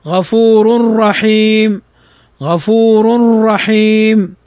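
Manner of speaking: "غفور رحيم" slowly with the tanween merged as "غفورُرّحيم"; slow Quranic recitation of "غفور رحيم". Shown as a demonstration. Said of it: "ننطقها هكذا".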